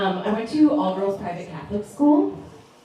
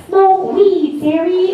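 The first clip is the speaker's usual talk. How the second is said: higher, louder mom urging; mom's voice in my head; 11 semitones higher, 4 dB louder, much slower than usual. Retold or imitated. Mom's voice in daughter's head, a higher coaxing urge. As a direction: coax like mom inside her head. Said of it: "my mom's voice in the back of my head".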